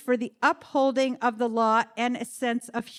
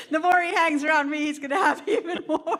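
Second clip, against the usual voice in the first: laughing; 4 semitones higher, 4 dB louder, faster than usual. Real, not acted. Teasing the guest, laughing.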